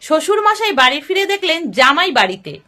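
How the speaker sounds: ordinary delivery, nothing marked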